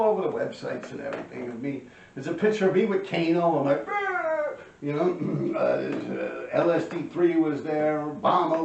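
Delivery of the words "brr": high, sustained trilled brr; playful high-pitched drawn-out brr; imitated noise. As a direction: hold a high buzzing noise. Imitates himself in a photo.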